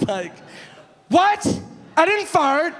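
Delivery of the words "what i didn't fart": high, loud woman's protest; hammed-up outraged squawk; imitated indignant denial. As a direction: mimic a falsely accused woman. Accused woman shrieks her denial.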